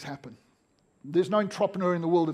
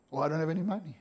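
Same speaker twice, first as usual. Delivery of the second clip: quiet, flat-out excuse, softer and lower; dismissive brush-off; 4 semitones lower, 8 dB quieter, faster than usual. Acted. Customer brushing off an unwanted seller, quietly dismissive. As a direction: fob off a seller softly.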